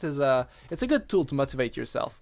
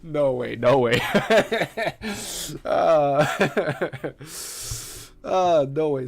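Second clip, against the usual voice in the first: laughing; 5 dB louder, slower than usual. Real, not acted. Laughing at own whisper bit.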